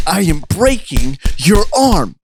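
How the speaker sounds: slow, high, word-by-word exertion; straining with effort. Acted. Best bangs Damien's arm on his knee, straining. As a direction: force each word out while struggling.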